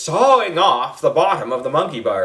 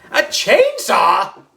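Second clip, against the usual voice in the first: alarmed; 6 semitones higher, 4 dB louder, much slower than usual. A narrator's reading. Kids freaking out.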